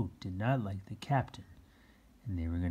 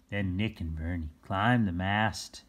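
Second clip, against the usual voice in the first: ordinary delivery, nothing marked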